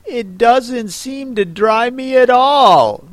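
high, drawn-out doleful complaint; melancholy. A narrator's reading. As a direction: lament it slowly in a high voice. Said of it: "said Alice in a melancholy tone".

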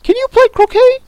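very high, loud question; piercing royal demand; shouting Queen. A narrator's reading. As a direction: screech it like a tyrant queen. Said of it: "shouted the Queen".

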